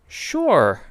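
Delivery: put-on a bright impressed young girl; girl thinks the name is cool; Katz voice. A narrator's reading.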